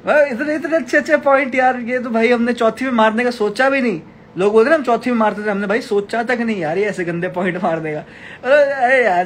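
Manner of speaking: sarcastic praise. Pretend. Streamer mockingly praises a viewer's insulting comment, gushing sarcastically. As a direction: overdo the amazement to mock the insult.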